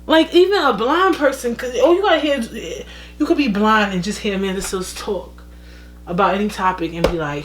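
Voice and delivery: ordinary talk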